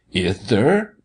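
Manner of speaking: "Ith, sir" with th in place of s; childish lisp. A narrator's reading. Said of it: "All that he lisped".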